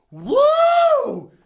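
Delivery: very high, loud whoop; sudden falsetto woo, long and loud; imitated startled whoop. Bison poked in the rear end, comic startled whoop.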